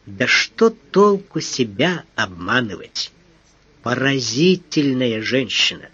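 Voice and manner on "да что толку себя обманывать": plain reading voice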